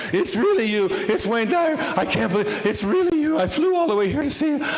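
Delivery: put-on high, loud, thick Dutch accent. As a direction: play an overexcited Dutch fan. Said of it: "in this heavy, thick, uh, Dutch accent".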